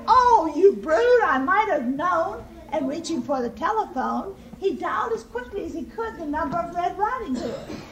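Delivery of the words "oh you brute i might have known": loud, raised piggy outcry; indignant cry. A narrator's reading. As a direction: cry out at the villain.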